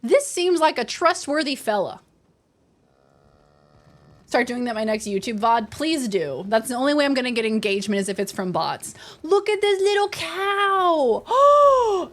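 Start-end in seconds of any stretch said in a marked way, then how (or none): 0.0-2.0 mock gullible spam bot. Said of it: "spam bots talking to each other and be like". 9.2-12.1 very high, drawn-out, loud squeal of delight; excited cooing. Real, not acted. Cooing over an animal in game.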